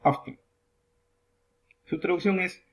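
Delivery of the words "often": OFTEN with T and N joined, no vowel between; no middle vowel. Shown as a demonstration. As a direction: say "often" running T straight into N. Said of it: "sin ninguna vocal al medio". ("often" con T y N juntas, sin vocal en medio)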